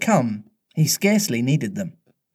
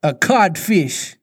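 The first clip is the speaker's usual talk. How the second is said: slow, slightly raised, stunned echo; taken-aback captain, slow; blank echo; 4 semitones higher, 4 dB louder, slower than usual. A narrator's reading. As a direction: repeat it blankly, drawn out. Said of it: "Hook echoed blankly".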